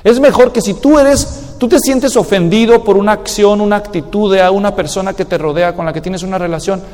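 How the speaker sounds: ordinary delivery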